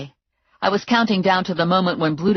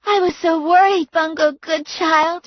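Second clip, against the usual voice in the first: put-on gushing girlfriend; 12 semitones higher, 3 dB louder than usual. A narrator's reading. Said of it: "she said".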